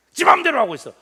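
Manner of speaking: loud, high husband's complaint; mock angry grumble. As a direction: snap it like an irritated husband. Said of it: "우리가 열받으면". (화난 남편 흉내)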